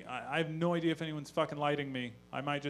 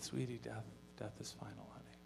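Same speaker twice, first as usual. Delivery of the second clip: quiet, gentle lowered voice; a dad hugging his daughter about death; soft tender comfort; 4 semitones lower, 13 dB quieter than usual. Retold or imitated. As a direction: break it to her kindly.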